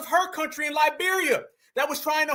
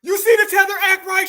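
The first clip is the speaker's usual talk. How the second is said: louder, higher mocking call-out; 7 semitones higher, 8 dB louder than usual. Real, not acted. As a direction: call it out to the viewers, loud and high. Mocking her tears loudly.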